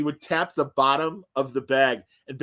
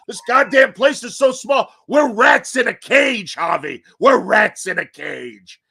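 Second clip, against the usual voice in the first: loud, higher, slow shouted complaint; shouting rant; 6 semitones higher, 9 dB louder than usual. Retold or imitated. Host ranting at the station manager about the small office, shouting. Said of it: "He goes".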